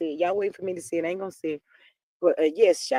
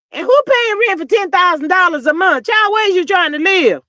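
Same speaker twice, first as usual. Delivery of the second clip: higher, louder disbelieving rant; incredulous outburst; 9 semitones higher, 9 dB louder than usual. Real, not acted. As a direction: blast it like you can't believe it. Outraged gossip about rent.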